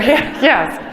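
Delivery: normal talking voice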